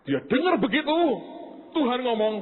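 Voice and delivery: ordinary talk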